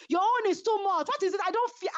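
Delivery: high, sharp retold complaint; quoting her complaint in a high heated voice; heated quote. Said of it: "I heard that said".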